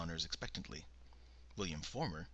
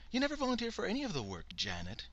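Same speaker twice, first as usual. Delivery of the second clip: Bernice, needling; 14 semitones higher, 3 dB louder, faster than usual. A narrator's reading. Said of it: "Bernice continued".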